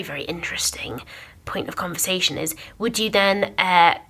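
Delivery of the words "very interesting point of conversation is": softly whispered aside. Real, not acted. Host drops into a whisper mid-thought. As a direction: lean in and whisper it.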